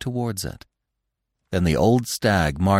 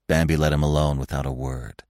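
ordinary delivery

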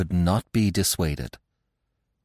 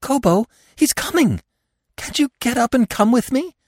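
far higher, louder panicked plea; frantic plea; 5 dB louder than usual. A narrator's reading. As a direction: beg him to rise. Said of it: "she whispered frantically".

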